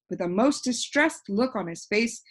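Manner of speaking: plain reading voice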